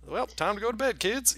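put-on high, sing-song goodnight to kids; bright mock parent tucking in; cheery bedtime voice. Mock cheery parent ending story.

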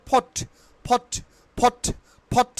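"ফট" with puffed P and silent R; correct pronunciation. Shown as a demonstration. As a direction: say "ফট" as short "phot". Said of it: "এটা এখন থেকে উচ্চারণ হবে". ("ফট", র ছাড়া ফ দিয়ে)

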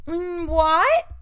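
high, loud scandalized what; mock shocked gasp. Riley shocked that Maya doesn't believe in God, high mock outrage. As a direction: act appalled at her friend's disbelief. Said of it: "And Riley's like".

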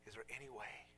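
soft, low, halting retelling; hushed imitated plea, fading nearly to whisper; understated tearful plea. Village woman begging for God's Word, quietly tearful. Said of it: "As tears are falling down her face".

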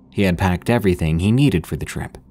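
usual speaking voice

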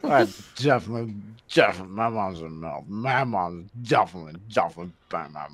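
low, soft, repetitive character voice; quoting a comedy bit. Acted. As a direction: keep repeating it in a low, muted voice. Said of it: "Are you quoting the g".

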